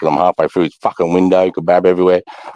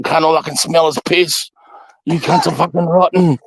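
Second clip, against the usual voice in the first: high-pitched mock officer; commanding officer's reprimand; 10 semitones higher than usual. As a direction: imitate an appalled officer dressing someone down. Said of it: "the C O's going".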